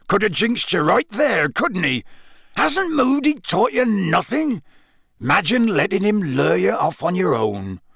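high, scolding character voice; heated rustic telling-off; Hagrid, angry. A narrator's reading. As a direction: scold with worried exasperation. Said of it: "Hagrid suddenly said angrily".

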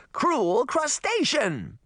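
high, slow, drawn-out command; princess's roar. A narrator's reading. Princess overrules her servant, high drawn-out roar. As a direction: roar each word long and high. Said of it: "she roared".

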